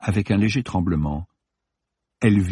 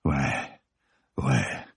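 much lower and softer murmur; 8 semitones lower, 7 dB quieter than usual. A narrator's reading. Ronan murmuring low to Samira, much lower and softer. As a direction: sink the voice down low. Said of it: "une note différente, très basse".

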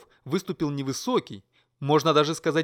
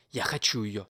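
low prince voice; 5 semitones lower, 4 dB quieter than usual. A narrator's reading. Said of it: "принц произнёс".

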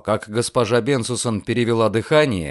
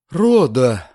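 loud cry of the name; landlady's loud reproach; shocked exclamation; 6 dB louder than usual. A narrator's reading. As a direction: call out in shock. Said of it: "воскликнула госпожа Бенсусон".

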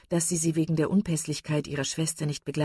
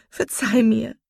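high, loud burst of remorse; impassioned cry; 6 semitones higher, 7 dB louder, slower than usual. A narrator's reading. Marian begs her sister's forgiveness, impassioned cry. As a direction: cry it out, pressing on "verzeih". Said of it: "rief sie".

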